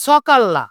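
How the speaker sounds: সকাল্লা with a hissed dental s, 'sokal-na'; wrong s pronunciation. Shown as a demonstration. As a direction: open সকাল্লা on s instead of sh. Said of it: "এটা উচ্চারণ হবে".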